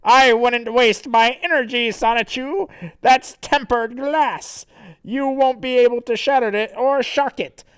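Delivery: high, loud gloating villain; theatrical threat broken by evil laughter; Santa. Acted. Villain taunts trapped Sonichu, loud and gloating. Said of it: "And then Santa says".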